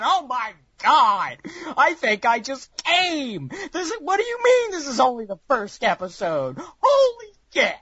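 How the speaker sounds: high, squealing overexcited fan; high breathless fanboy gushing; giddy fan. Pretend. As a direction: gush like a fan blown away, voice way up. Acting out a first-time viewer.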